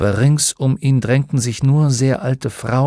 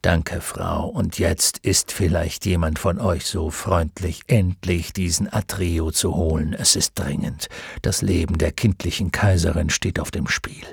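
lower, softer, weary centaur voice; exhausted centaur; 6 semitones lower, 4 dB quieter than usual. A narrator's reading. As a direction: urge them on, tired and grave. Exhausted centaur urging haste.

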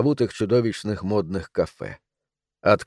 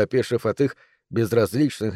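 ordinary delivery

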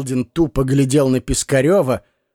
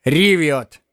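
grumbling. A narrator's reading. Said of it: "проворчал он".

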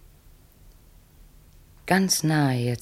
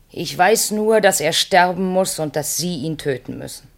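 sudden raised, determined declaration; firm insistence; 4 semitones higher than usual. Acted. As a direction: snap back to command. Insisting the man must die.

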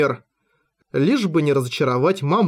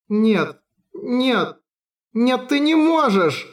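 wanting to scream; 9 semitones higher, much slower than usual. A narrator's reading. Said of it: "Хотелось завопить Рисе".

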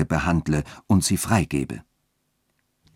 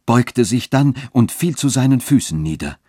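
plain reading voice

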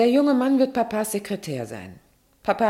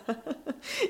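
short light laugh, high up; a mother's laugh slipping out at her daughters; amused laugh; 8 semitones higher, 10 dB quieter than usual. Acted. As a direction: laugh despite the scolding.

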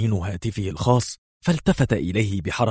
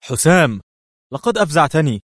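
high, raised exclamation of alarm; startled cry; 7 semitones higher, 5 dB louder than usual. A narrator's reading. Friend startled by sudden entrance, crying out. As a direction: exclaim as if jolted from the chair. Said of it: "وهو يهتف".

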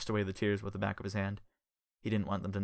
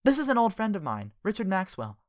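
high bright friendly woman; cheerful woman voice; 10 semitones higher, 6 dB louder than usual. Acted. Connie's voice in story.

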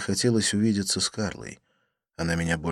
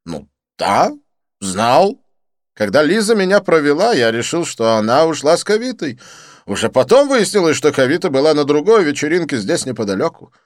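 high, loud, theatrical French friend; excited Didier; 8 semitones higher, 10 dB louder than usual. Acted. Didier greeting his friend Lin, high excited gush.

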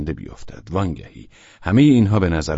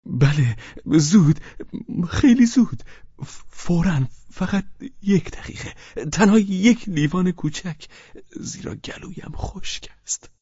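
high, hurried anxious pleading; nervous rush to get a drink; anxious and eager; 13 semitones higher than usual. Acted. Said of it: "با اضطراب گفت".

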